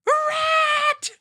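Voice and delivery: very high-pitched panicked scream; acted shrill scream of alarm. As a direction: shriek it in horror like a startled girl. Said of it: "and just screams".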